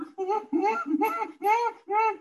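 high tearful laughing sob; laughing through tears at the memory; laugh-cry. Real, not acted. Nervous weeping laugh remembered.

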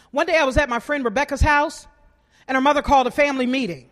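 ordinary delivery

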